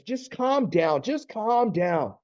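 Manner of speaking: high, slow, drawn-out placating; stretched-out mock soothing; condescending. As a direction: imitate a husband talking down to his wife. Acted husband dismissing wife's emotions.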